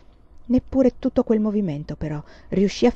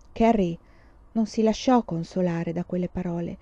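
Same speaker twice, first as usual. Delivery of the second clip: usual speaking voice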